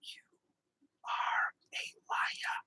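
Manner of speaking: slow unvoiced whisper, drawn out; slow playful whisper. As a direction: whisper it slowly, mock accusing. Teasing chat in a whisper.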